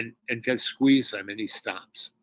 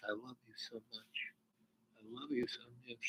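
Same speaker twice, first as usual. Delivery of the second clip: much softer, breathy tender "I love you so much"; tender whisper; 20 dB quieter than usual. Retold or imitated. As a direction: whisper it close, full of love. Girl's whisper, he nearly cries.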